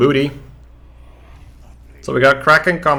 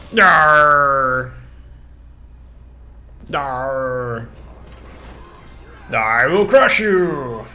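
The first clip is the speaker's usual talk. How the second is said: barbarian; 3 semitones higher, 5 dB louder, much slower than usual. Pretend. Roleplays barbarian battle cry.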